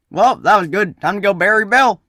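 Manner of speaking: high, loud, chipper call; cheerful announcement. Acted. Hunter finishing up before burying Bill, loud and chipper. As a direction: announce a grim chore brightly.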